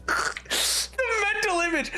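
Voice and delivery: high, laughing so hard it nearly sounds like crying; real laughing fit. Real, not acted. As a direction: talk through helpless laughter. Cracking up while describing a funny image.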